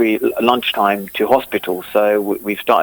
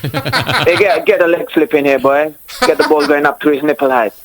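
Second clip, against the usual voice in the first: higher-pitched mock Jamaican patois; hammed-up Jamaican banter; Jamaican accent; 4 semitones higher, 3 dB louder than usual. Shown as a demonstration. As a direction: put on a lively Jamaican accent.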